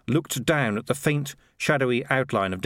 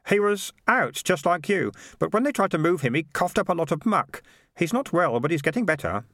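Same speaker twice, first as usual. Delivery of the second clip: a lightly voiced young lad, worried; higher boy's voice, Keith; 7 semitones higher than usual. Keith tells the hurt cat about the rat, lightly voiced young lad. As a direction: speak up high like a concerned boy.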